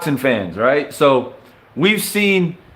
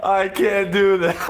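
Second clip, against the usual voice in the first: ordinary talk, nothing marked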